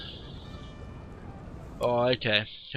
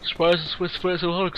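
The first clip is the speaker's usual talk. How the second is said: yawning; 6 semitones higher than usual. Real, not acted. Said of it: "Sorry for yawning".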